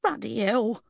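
constable's appalled outburst; disgusted exclamation. A narrator's reading. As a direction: curse in revulsion. Constable disgusted by vomit on boot.

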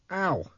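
high "ow" of sudden pain; pained yelp. A narrator's reading. Man kicked in the shin, high pained yelp.

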